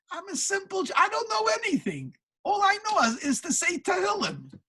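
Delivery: high-pitched modest protest; acted self-effacing protest, voice lifted high; humble protest. Humble scholar protesting he knows nothing.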